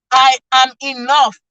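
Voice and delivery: slow, firm declaration; said feelingly. Shown as a demonstration. Preacher leads the crowd in affirmation, slow and firm. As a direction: plant each word like you believe it. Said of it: "I need you to say it feelingly".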